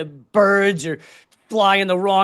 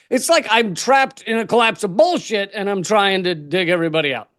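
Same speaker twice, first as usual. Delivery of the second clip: usual speaking voice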